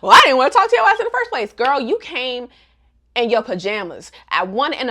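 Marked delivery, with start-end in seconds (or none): 0.0-1.5 loud, high, very fast retort; hammed-up indignant burst; mock mad outburst. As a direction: imitate an angry texter snapping back. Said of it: "Agatha started getting mad".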